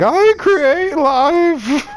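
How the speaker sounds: very high, very slow hammy overacting; Eddie Redmayne overacting. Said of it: "he just delivers it like that".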